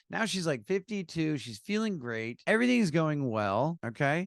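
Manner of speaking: ordinary talk, nothing marked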